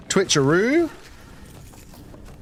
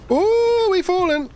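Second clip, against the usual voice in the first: surprised; 4 dB louder than usual. Real, not acted. Sudden fall in game.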